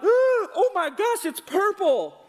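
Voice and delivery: high, loud, slow hammed-up dismay; mock shock. Pastor acts out his younger self seeing the shirt turn purple, hammed-up shock. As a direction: play up the shock of ruined dye. Retold dye turning purple.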